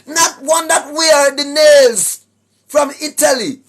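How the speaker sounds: ordinary talk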